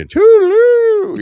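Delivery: put-on very high, slow, loud old-lady trill; Mrs. Doubtfire voice. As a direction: draw it out like a fussy nanny. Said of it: "you know, like that".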